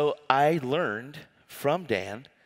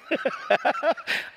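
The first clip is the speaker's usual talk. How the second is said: high laughing fit. Real, not acted. Breaks up laughing at own imitation.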